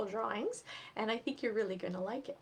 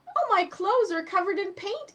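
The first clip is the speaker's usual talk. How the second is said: whining; 10 semitones higher, 7 dB louder than usual. Acted. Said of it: "Eddie whined".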